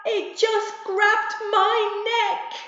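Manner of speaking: high, loud screaming in terror; terrified scream. Acted. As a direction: scream like the monster has you. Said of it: "screamed at the top of her lungs".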